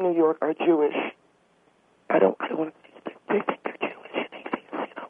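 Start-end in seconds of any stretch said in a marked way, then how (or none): 2.4-5.1 unvoiced whisper into the phone. Real, not acted. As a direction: whisper it so nobody hears. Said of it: "Why are you whispering?".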